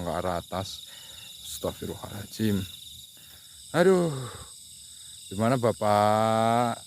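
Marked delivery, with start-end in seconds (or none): 3.7-6.8 loud, slow call; loud, drawn-out call to an unseen man. Real, not acted. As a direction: stretch the call into the night. Calling out to a spirit.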